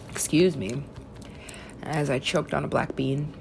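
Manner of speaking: ordinary talk, nothing marked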